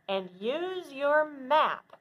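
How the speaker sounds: ordinary talk, nothing marked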